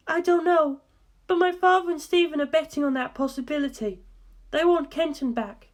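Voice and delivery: a hint of a grave woman asking; earnest, probing question, lightly voiced; a faint woman's voice, asking seriously; lightly voiced Darlington. A narrator's reading.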